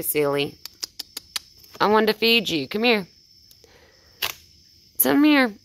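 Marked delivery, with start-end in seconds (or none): 1.8-5.5 higher, louder calling out; coaxing call to a raccoon; calling the animal. Real, not acted. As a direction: call her over, rising to a shout.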